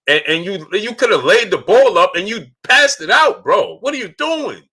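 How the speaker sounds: high-pitched exasperated yelling; real emotional outburst. Real, not acted. Fan berating Blake Griffin for passing it out, high emotional outburst. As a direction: yell at the player in high-pitched disbelief. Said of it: "I get emotional about".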